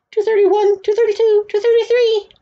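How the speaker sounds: very high, loud count; frantic counting. A narrator's reading. Boy desperately tearing off hats, counting shrilly high. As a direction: squeal out each number at a high pitch.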